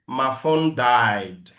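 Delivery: slow American-accented model. Shown as a demonstration. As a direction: say it like an American, drawn out. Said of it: "speak English like an American". (accent américain, lent)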